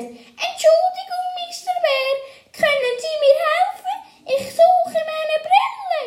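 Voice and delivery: very high-pitched little bookworm voice; tiny creature's voice. Acted. Bert asks the bear for help, tiny high voice. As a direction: squeak up politely to a huge bear.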